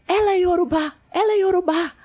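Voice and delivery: high excited announcing; excited repeating. Retold or imitated. Officers telling each other excitedly, high and eager. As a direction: spread the news with delight.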